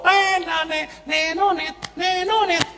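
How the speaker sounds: high sing-song ringing noise, drawn out; imitated phone ringtone. Priest mimics the wife's call coming in, high sing-song ringtone.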